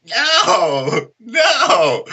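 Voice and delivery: high, drawn-out protest; mock reluctant refusal. Fighter refusing his eager coach, mock high protest. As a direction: wail the refusal in mock dread.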